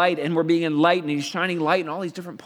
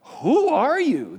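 demanding crowd; 10 semitones higher, 4 dB louder, much slower than usual. Acted. Crowd demanding, acted by preacher.

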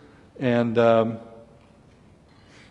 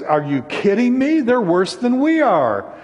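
incredulous protest; 8 semitones higher, 4 dB louder than usual. Acted. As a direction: protest in disbelief, pitch jumping up. Acts out Habakkuk's disbelieving protest.

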